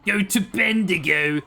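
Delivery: mock English accent, half Australian; British geezer accent. Streamer mimicking a British guy on benzos, mock British accent. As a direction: put on a slow, hammy British geezer. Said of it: "a drug a British guy would take".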